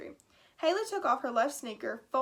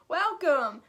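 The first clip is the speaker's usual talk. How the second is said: loud booming ceiling voice; booming welcome; 3 semitones higher, 7 dB louder than usual. A narrator's reading. Ceiling speaker booms a welcome to Haley, loud. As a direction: boom it out like a speaker system. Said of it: "boomed a voice from the ceiling".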